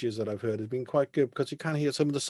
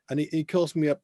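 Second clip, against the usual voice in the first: ordinary talk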